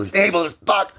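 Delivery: high, loud burst of bitterness; furious outburst. Real, not acted. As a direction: erupt in anger at dad. Outburst against dad, then praises him.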